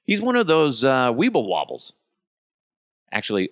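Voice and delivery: ordinary talk, nothing marked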